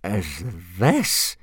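drawn-out aghast question; horrified, rising query; appalled demand. A narrator's reading. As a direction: finish the question in disbelief. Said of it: "demanded Gobber, blanching a little".

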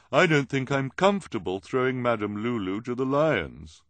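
high, loud character voice; put-on high voice. A narrator's reading. Violet in disguised voice.

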